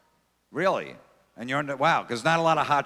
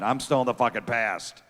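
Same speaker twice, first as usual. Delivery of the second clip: usual speaking voice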